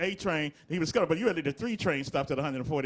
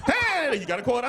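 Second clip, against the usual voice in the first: a high, louder "Hey" calling out, then the ask; louder panhandler call; 6 semitones higher, 5 dB louder than usual. Retold or imitated. Panhandler calling out to him, high and louder. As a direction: hail him down for a quarter.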